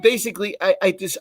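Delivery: ordinary talk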